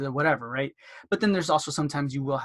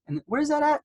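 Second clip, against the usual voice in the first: friendly caller; 12 semitones higher, 4 dB louder, faster than usual. Acted. Acted chat with a patient.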